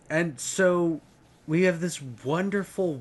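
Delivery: ordinary delivery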